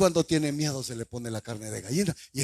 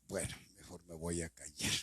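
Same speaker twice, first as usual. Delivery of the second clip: muttered aside; 10 semitones lower, 16 dB quieter than usual. Real, not acted. Preacher mutters, cuts himself off.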